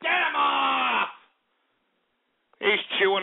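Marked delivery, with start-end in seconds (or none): 0.0-1.1 high shout, last word stretched out; shouted order. Real, not acted. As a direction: yell to have the caller cut off. Ordering caller cut off.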